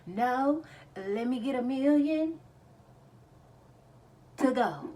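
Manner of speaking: high, loud mock order; exaggerated reply on the edge of laughter; joking comeback. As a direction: play it big, as a silly order. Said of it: "I be like".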